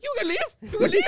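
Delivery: high-pitched mock Indian accent; imitating an irate Indian-accented man; screaming heavy accent. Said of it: "such a heavy accent like that screaming at you".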